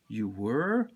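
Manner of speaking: low start swooping up into a question; wide-eyed surprise. A narrator's reading. Boy learning Daddy was scared too, surprised. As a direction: ask it in mild wonder. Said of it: "I say with my eyes wide open".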